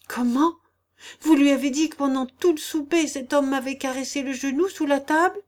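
high, loud incredulous questioning; indignant disbelief. A narrator's reading. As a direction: challenge him, aghast that he told. Wife indignant at lord telling all. (indignation incrédule, voix haute et forte)

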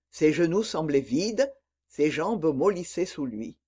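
usual speaking voice

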